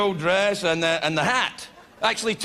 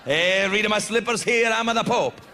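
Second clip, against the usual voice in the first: slow mock Italian accent; the Pope. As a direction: show off in a hammy Italian accent. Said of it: "the Pope doesn't talk like that".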